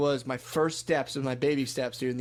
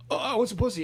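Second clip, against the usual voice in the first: high, excited outburst; mock eager demand; 9 semitones higher than usual. Lucid dreamer realizing he has control, mock eager demand. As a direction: blurt it out greedily, pitched way up.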